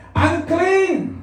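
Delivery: high-pitched cry of warning; leper's warning shout. Acted. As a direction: shout it out so people move aside. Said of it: "Sisigaw siya".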